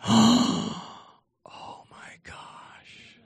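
slow, drawn-out whisper of amazement; hushed wonder, acted for effect; awed whisper. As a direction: whisper it slowly, as if stunned. Acts out the amazed room.

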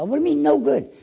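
arguing with his lawyer over the name; indignant disbelief. Retold or imitated. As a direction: push back in disbelief.

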